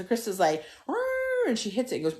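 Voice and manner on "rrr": high-pitched imitated car "rrr"; high engine rev. Storyteller mimicking the car gunning it, high revving noise.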